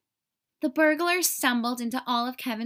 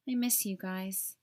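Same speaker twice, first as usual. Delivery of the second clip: whispered; 4 semitones lower, 6 dB quieter than usual. A narrator's reading. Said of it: "he whispered".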